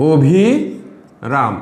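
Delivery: অভিরাম stretched out, every syllable very slow; very slow teaching demo of অভিরাম; slow drawn-out pronunciation. Shown as a demonstration. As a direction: spell অভিরাম out slowly for the class. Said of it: "অভিরাম বলেছি".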